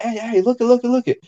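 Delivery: high, urgent calls to a friend; excited calling out. Retold or imitated. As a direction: pitch it way up to grab attention. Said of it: "I was like".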